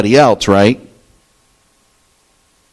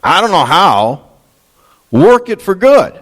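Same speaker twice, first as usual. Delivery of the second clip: climbing higher and higher into a desperate plea; pleading prayer; 6 semitones higher, slower than usual. Retold or imitated. Preacher voicing a believer's prayer, rising desperate plea. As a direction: cry out to the Lord, pitch rising to a peak. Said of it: "and you can say".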